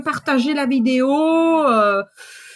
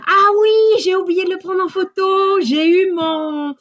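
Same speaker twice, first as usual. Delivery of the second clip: much higher and louder, caught by surprise; sudden remembering; 5 semitones higher, 4 dB louder than usual. Real, not acted. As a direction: blurt it out as it comes back to you. Suddenly remembers the bouquet photo.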